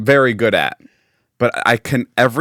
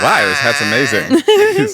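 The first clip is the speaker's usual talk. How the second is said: much higher and slow, mock gushing; sarcastic delight; 11 semitones higher, 4 dB louder, slower than usual. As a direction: gush over the hat with fake wonder. Sarcastic retold reply to stranger.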